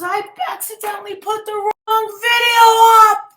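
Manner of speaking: high, loud, frantic blurt; retelling his partner's panicked shout; panicked confession. Retold or imitated. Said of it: "She goes".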